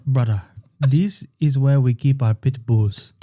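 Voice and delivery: careful warning in a put-on Sudanese accent; Sudanese man. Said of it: "a guy from the Sudan who turns".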